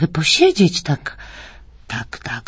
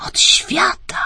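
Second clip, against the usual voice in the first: whispered, mysterious; slower than usual. Acted. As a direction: hush it like a secret. Mother whispers secretly to child.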